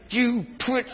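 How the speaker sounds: high, strained mock outburst at a driver; mock road rage. Priest imitating an angry driver, hammed up. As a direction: act out a fuming driver.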